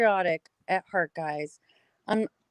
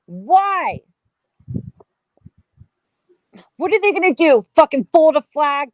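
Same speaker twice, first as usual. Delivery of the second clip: loud, raised, furious ranting; angry rant; 3 semitones higher, 5 dB louder than usual. Real, not acted. Ranting to listeners about veterans' funerals, furious and loud. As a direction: vent fury at how the country treats veterans.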